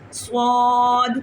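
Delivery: سواد drawn out slowly, hissed and full-mouthed; heavy whistled sad. Shown as a demonstration. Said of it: "دال کی آواز".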